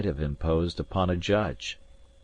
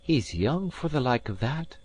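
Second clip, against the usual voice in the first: maid's voice; 7 semitones higher than usual. A narrator's reading. Maid speaks.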